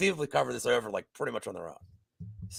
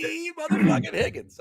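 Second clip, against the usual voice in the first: playful yell; 7 semitones higher than usual. Acted. Bettor hailing his favorite player, hammed-up yell. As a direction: holler the name like a catchphrase. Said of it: "That's why I yelled it".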